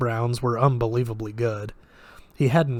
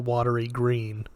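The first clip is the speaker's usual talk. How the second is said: usual speaking voice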